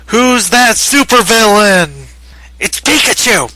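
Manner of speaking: high, loud mock TV announcer; hammed-up, high-pitched announcer shout; cartoon announcer parody. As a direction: call it out like a cartoon quiz bumper. Parodying a TV cartoon quiz bumper.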